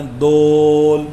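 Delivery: دول chanted long with a rounded o; old man's dool. Retold or imitated. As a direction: imitate the old man drawling دول. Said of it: "تو وہ کہتے ہیں".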